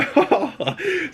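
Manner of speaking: sudden squealing giggle; high burst of laughter. Real, not acted. Laughs at nasty bucket image.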